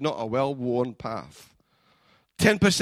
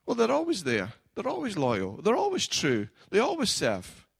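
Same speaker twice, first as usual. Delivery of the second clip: taking for granted; 6 semitones higher than usual. Acted. Voicing those who take spouses for granted.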